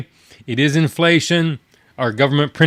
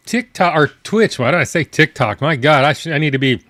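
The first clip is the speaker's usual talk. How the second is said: ordinary talk, nothing marked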